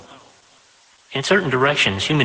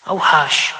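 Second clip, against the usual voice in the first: vaguely shocked; 4 semitones higher, 4 dB louder than usual. Acted. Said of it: "vaguely shocked".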